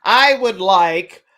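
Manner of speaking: slow, loud, over-enunciated mock request; exaggeratedly measured mock speech; mock articulate request. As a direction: draw out each word loudly. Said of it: "you can't say".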